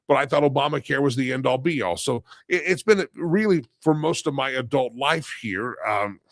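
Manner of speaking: usual speaking voice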